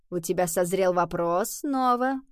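plain reading voice

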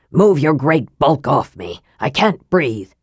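low-pitched irritable complaint; testy, low character voice. A narrator's reading. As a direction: complain as if squashed and annoyed. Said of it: "said Raistlin testily".

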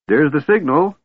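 high-pitched, eager exclamation; excited shriek. A narrator's reading. Kender sees the signal to fly, shrieking with excitement. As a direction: pipe up high, thrilled at the signal. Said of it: "Tass shrieked".

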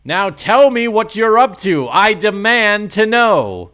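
high, loud shouted demand; loud angry demand. Acted. Norman demands after switch thrown.

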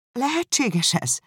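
high, slow, disbelieving cry; astonished exclamation. A narrator's reading. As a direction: cry out in amazement, drawn out. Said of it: "kiáltott fel Elizabeth". (meglepett, hitetlenkedő felkiáltás)

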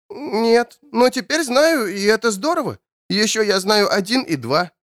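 put-on high, small voice of a little boy; small child voice. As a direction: sound like a timid little kid. Child character voice.

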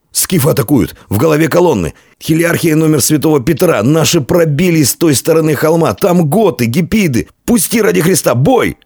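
high-pitched, frantic messenger; panicked report. A narrator's reading. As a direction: cry out news of the attack.

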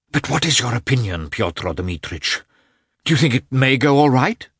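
high, loud anxious question; nervous husband pressing the doctor; anxious asking. A narrator's reading. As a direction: press him for reassurance.

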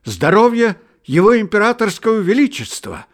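high, loud, ringing toast; rapturous toast. A narrator's reading. Nikolka jumping up and proposing a toast to the tsar, rapturous and loud. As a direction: raise the glass and cry it out with rapture. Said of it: "вдруг восторженно заговорил он".

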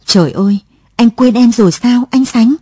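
high, loud anguished plea; distressed, close to sobbing; tearful outcry. A narrator's reading. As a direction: cry out in hurt disbelief. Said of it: "Lụa khóc nức lên".

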